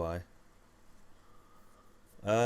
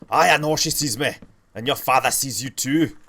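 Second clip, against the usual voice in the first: loud, higher put-on Scottish accent; Scottish accent impression; 5 semitones higher, 7 dB louder than usual. Shown as a demonstration. Streamer showing off his Scottish accent to chat, loud Scottish put-on. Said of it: "I sometimes do a Scottish impression".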